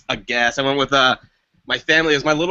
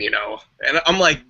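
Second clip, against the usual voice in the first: normal talking voice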